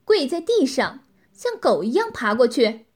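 loud, harsh order from a domineering woman; stern shouted command. A narrator's reading. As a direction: bark it out as an order. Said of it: "女子厉声喝道".

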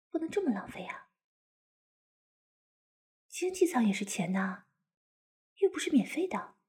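scolding whisper. Acted. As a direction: hush it, scold softly. Whispering to husband in cabin. (小声耳语责备)

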